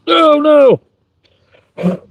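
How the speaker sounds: high, sudden alarmed cry; startled cry. Real, not acted. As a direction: yelp in dismay. Wood chips spill into food.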